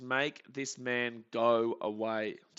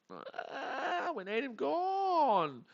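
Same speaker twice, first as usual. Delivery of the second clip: desperate plea; 13 semitones higher, much slower than usual. Real, not acted. Desperate fan pleading with pitcher.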